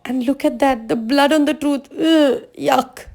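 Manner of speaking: high-pitched, aghast tortoise; shocked. A narrator's reading. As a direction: gasp it out in disgusted disbelief at the little bear. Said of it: "The tortoise was shocked".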